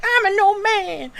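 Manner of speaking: high, slow, mock frail old man voice. Joking that Stone Cold isn't weak, mock feeble old man.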